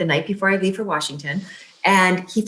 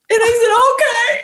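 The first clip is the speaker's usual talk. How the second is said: laughing through tears; 4 dB louder, much slower than usual. Real, not acted. Overwhelmed at end of call.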